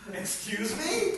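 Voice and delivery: high, slow, incredulous excuse me; mock disbelief. Preacher to congregation, doubting the fasting claim, mock disbelief. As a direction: play it as a stunned double take.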